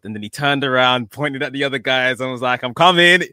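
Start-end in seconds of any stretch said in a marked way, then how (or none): 2.6-3.2 loud, raised-pitch call; excited quote, pitched up and loud; retold call-out. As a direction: call it out to the others like the wrestler. Retelling wrestler calling out to others.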